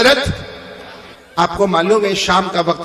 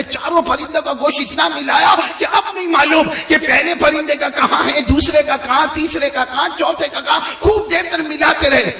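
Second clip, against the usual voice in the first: high, driving sermon delivery; pitched-up preacher's rally; impassioned preaching; 5 semitones higher than usual. Acted. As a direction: rouse the congregation to count along.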